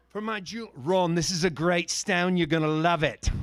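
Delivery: mock South African lilt for the bidder; hint of a South African accent. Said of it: "now I'm gonna go into my South African accent".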